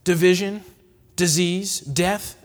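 ordinary delivery

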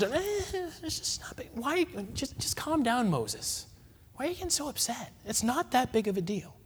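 hushed, placating voice of Aaron; calm the angry brother down in a near whisper; Aaron soothing Moses, soft and dismissive. Pretend. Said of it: "put it this way".

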